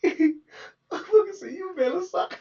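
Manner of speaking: high, breaking laughter through the words; laughing while retelling. Real, not acted. Retelling the jab, cracking up with laughter. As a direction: laugh your way through the quote.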